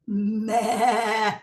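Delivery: bleating goat voice; mock goat bleating.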